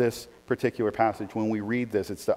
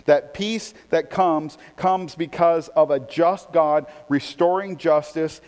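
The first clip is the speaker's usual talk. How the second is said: raised, driving sermon voice; preaching; 5 semitones higher, 4 dB louder than usual. Real, not acted. Pastor exhorting the congregation, forceful preaching. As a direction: preach it with rising force to the church.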